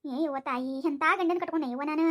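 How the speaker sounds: low, subdued wail of self-pity; muttered woe-is-me complaint; weary lament. Acted. Wife bewails her husband.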